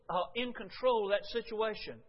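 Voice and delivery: ordinary talk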